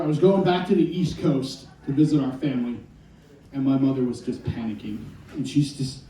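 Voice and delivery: ordinary delivery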